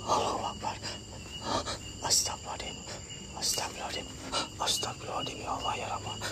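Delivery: breathless whispered prayer, barely audible; whispered prayers in pure fear; frightened whisper. Real, not acted. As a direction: hush it out so nothing hears you. Scared praying while hiding from ghost.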